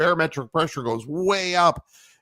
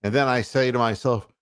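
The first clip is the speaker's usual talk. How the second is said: ordinary delivery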